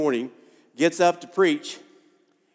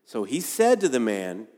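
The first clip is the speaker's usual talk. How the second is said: ordinary delivery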